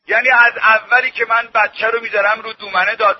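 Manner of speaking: ordinary delivery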